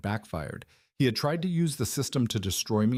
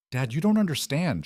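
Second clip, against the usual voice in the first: high, urgent, a little breathless; gasping plea; 7 semitones higher, 3 dB louder than usual. A narrator's reading. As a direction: gasp it out in fear. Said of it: "she gasped".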